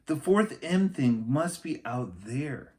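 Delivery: put-on high, eager little girl voice; Annie voice. A narrator's reading. Narrator reading aloud gives Annie a high eager voice. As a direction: voice Annie as a bright, eager kid.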